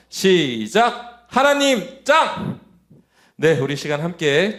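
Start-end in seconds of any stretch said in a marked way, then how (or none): 0.1-2.6 slow, high, drawn-out group shout; loud cheer. Real, not acted. Preacher leads children in a closing chant, loud cheer. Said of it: "큰 목소리로 외쳐볼까요".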